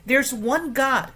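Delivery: "There's one God" slow and loud, high on "There's"; emphatic statement. Shown as a demonstration. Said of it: "你相信什么呢".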